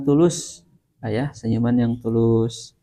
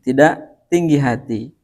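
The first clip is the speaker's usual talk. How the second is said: normal talking voice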